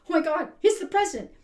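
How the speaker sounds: high, loud gasp of amazement; shocked crowd. Retold or imitated. Crowd seeing Obama walk by, shocked and starstruck. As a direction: blurt it out as if starstruck. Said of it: "people were in shock".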